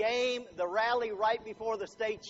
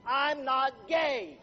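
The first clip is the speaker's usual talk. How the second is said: angry retort; 5 semitones higher, 4 dB louder, much slower than usual. Retold or imitated. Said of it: "more anger in his eyes".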